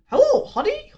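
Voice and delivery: high, chirpy husband greeting; arriving husband. Acted. Husband walks in on his grieving wife, chirpy high greeting. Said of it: "husband comes in".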